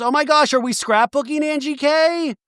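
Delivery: high-pitched gushing imitation; hammed-up high chirp; mock excitement. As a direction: squeal the question in mimicry. Imitating Heather's excited question.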